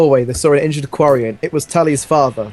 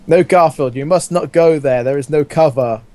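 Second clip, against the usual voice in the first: ordinary talk, nothing marked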